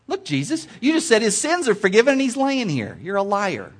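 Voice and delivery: high, loud mock indignation; acted accusation. Acting out the scribes accusing Jesus.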